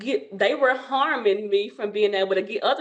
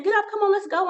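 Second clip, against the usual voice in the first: urgent husband; 11 semitones higher, faster than usual. Retold or imitated. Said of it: "he was saying".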